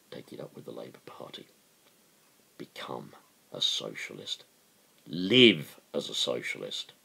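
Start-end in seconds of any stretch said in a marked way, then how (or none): 0.1-4.4 fast hushed whisper. Real, not acted. Urging listeners toward the Labour Party, whispered quickly. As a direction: slip it to them under your breath.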